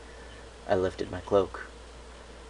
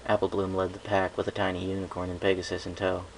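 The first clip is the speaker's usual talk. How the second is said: ordinary delivery, nothing marked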